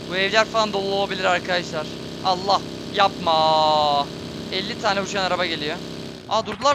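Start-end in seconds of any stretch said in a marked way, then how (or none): 2.2-4.1 slow, louder pleading groan; long stretched real plea; drawn-out plea. Real, not acted. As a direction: beg the game not to do it, slowly. Enemies closing in, pleading.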